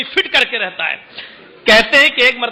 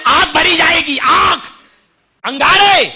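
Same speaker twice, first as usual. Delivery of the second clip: thundering shout; 4 semitones higher than usual. Acted. Preacher warns the congregation of fire in the mouth, shouting. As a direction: hurl the word like a warning of fire.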